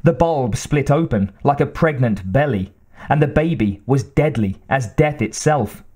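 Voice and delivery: ordinary delivery, nothing marked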